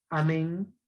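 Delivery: Amén with the E leaned on; stress on last syllable. Shown as a demonstration. Teacher reading the next word, stress on MÉN in Amén. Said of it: "fuerza de voz está en la E".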